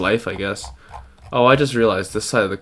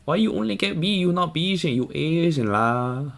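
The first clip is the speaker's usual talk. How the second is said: Stephen He; 11 semitones higher than usual. Retold or imitated. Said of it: "Stephen He, like".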